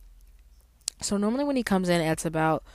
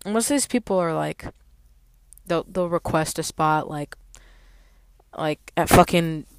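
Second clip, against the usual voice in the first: ordinary talk, nothing marked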